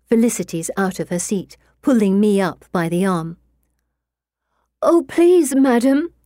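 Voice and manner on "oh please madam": high, loud, tearful plea; on the verge of tears. A narrator's reading. Girl begs the medium while fighting back laughter, feigned tearful plea. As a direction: plead as if about to cry. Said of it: "sounding like she's on the verge of tears".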